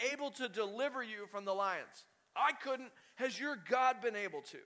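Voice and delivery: high, loud acted cry of anguish; king crying out, pitched high and urgent. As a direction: call out desperately to someone down in the den. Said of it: "he cried out in a tone of anguish".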